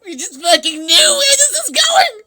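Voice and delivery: high, loud wailing outburst; squealed exasperation, rising high; exasperated wail. Real, not acted. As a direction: cry out in comic dread. Exasperated retelling.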